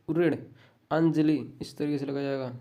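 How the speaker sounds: ordinary talk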